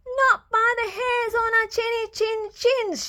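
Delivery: high, brave piglet shout; defiant shout. Acted. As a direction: stand firm and shout it. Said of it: "shouted Percy".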